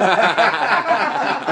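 hearty real laugh; big laugh. Real, not acted. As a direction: burst of high loud laughter. Laughs at own bus story.

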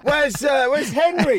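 hammed-up shout calling for his missing coach; mock shout. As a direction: bellow like a fighter whose corner vanished. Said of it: "'Cause he was like".

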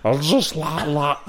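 retried catchphrase, laughter breaking in; lightly voiced Tommy Cooper; Tommy Cooper impression. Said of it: "Let me do it again".

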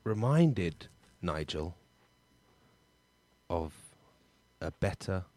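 hammed-up melodrama, word by word; word relay. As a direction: act the soap romance one word each. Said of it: "It's a one-word story".

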